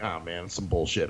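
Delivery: low, quiet grumble under the breath; retold sulky mutter, dropped way down; annoyed grumbling. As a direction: grumble it to yourself, a little annoyed. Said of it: "I'm kinda grumbling 'cause I'm a little annoyed".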